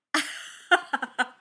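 loud, high burst of laughter; hearty laugh. A narrator's reading. Thor laughing on the floor after his fall, hearty. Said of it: "Thor was laughing".

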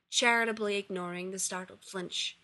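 ordinary delivery

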